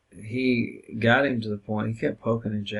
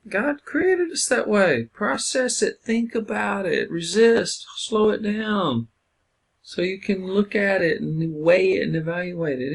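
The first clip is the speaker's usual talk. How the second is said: impassioned; 9 semitones higher, 5 dB louder than usual. Real, not acted. Impassioned teaching point.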